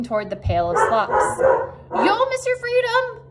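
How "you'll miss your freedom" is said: very high goose voice; goose honking. A narrator's reading. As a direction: honk a warning, pitched way up. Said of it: "honked the goose".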